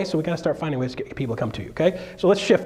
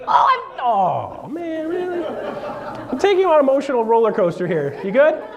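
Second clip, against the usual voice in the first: much higher, laughing mock swoon; higher, teasing laughing voice; teasing woman; 9 semitones higher than usual. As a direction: play the listener, squealing and laughing. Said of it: "She's like".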